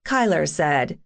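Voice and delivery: ordinary delivery, nothing marked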